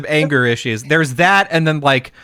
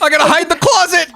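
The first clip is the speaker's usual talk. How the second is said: high-pitched, rushed, scared retelling; mock panic; 12 semitones higher, faster than usual. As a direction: imitate someone frantically scrambling to hide. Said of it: "just scared".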